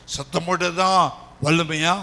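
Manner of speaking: ordinary talk, nothing marked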